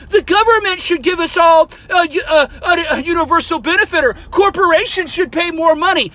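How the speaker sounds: high mocking voice of a liberal. Mocking the people who pay for strangers' coffee, high-pitched. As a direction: parody the do-gooders.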